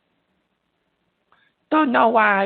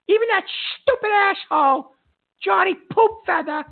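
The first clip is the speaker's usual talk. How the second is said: irritated rant; 7 semitones higher than usual. Acted. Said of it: "I get irritated and I start screaming".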